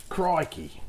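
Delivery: badly faked Australian exclamation; terrible Australian impression. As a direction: do a jokey, poor Australian accent. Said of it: "a terrible impression".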